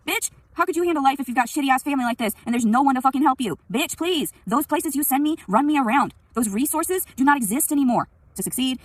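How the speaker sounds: loud, high, indignant outburst; angry rant. Real, not acted. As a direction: tear into the doubters angrily.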